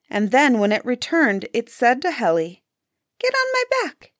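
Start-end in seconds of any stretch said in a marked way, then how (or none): 3.2-4.1 high, piping bird voice; talking grouse. A narrator's reading. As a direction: speak as a little bird making a request, pitched way up. Grouse invites the hunter to ride.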